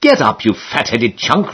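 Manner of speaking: ordinary delivery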